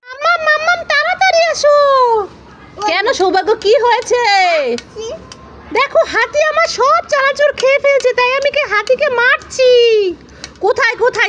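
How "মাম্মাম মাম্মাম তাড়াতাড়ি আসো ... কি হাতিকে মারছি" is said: put-on high, eager little boy calling out; child voice. Little son calling mummy about the elephant, in a high urgent child voice. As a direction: call mummy like an excited toddler.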